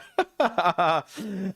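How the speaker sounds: high strained laugh through the burn; laughing while the mouth burns; pained laugh. Real, not acted.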